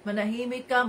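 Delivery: ordinary talk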